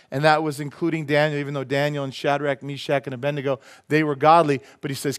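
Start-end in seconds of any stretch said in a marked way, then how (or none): none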